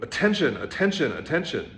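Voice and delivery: a loud triple insistence that eases off; emphatic repetition fading. Acted. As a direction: hammer it, then let it land. Master repeats word, climax.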